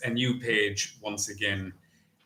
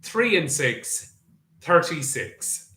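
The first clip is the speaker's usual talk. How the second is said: bingo caller; 3 semitones higher than usual. Acted. Bingo number calling.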